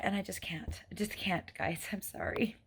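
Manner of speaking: breaking down, whispering through real tears; barely voiced, holding back sobs; tearful whisper. Real, not acted. Worn-out mom tells viewers she can't go on, real tearful whisper. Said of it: "my glasses are all covered in tears".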